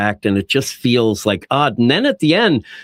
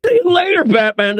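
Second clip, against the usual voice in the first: high-pitched singsong goodbye, acted for laughs; mock cheery farewell; 3 dB louder than usual. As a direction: call it out in a much higher mock voice. Jokes about waving goodbye with stump.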